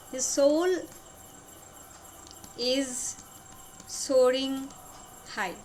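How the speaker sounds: long pause before each of the last words; word-by-word dictation. Shown as a demonstration. Teacher repeating the lover's feeling for students, word-by-word dictation. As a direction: space the words far apart.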